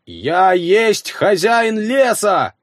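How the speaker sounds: slow inhuman roar; eldritch horror. Acted. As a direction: roar like a forest monster. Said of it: "взревел я самым нечеловеческим голосом".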